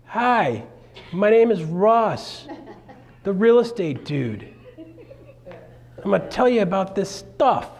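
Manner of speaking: a slow, cheery intro with a laugh; demo opening intro. Shown as a demonstration. The coach demos his video opening, slow and friendly. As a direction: show the simple opening. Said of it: "So here's my opening".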